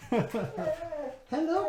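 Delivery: high-pitched greeting to a dog; puppy talk. Real, not acted. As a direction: coo a high hello to your puppy.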